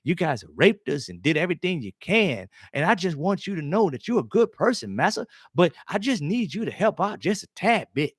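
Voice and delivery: high, pleading, sing-song mock begging; mock begging slave. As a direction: beg the master in a hammed-up whine. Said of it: "on bended knee".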